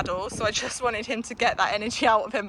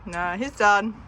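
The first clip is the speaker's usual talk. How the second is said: ordinary delivery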